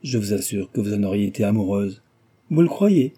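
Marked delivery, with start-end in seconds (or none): 2.5-3.1 high, louder question with a touch of amusement; lightly acted laughing reply, pitched up; amused retort. A narrator's reading. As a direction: toss it back as if amused. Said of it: "Repartit Cécile en riant".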